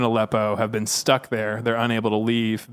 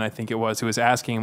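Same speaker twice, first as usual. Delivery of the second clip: normal talking voice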